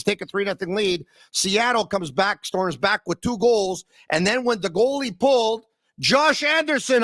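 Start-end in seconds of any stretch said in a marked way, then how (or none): 6.0-7.0 excited call. Acted.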